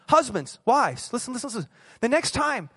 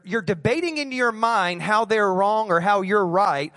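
usual speaking voice

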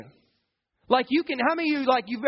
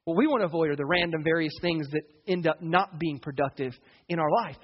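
ordinary talk

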